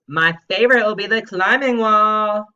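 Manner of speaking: put-on high, loud kid voice; loud eager imitation of a student; imitating Doobie. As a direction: mimic the student's eager read. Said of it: "I know that's how you sounded".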